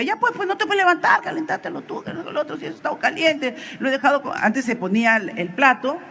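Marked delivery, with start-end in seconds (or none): 0.0-4.3 high-pitched mock-annoyed wife; annoyed retort. Wife snapping back at husband over hot food, annoyed retort. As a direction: retort crossly in a raised pitch, imitating her. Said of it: "se enojaba, decía".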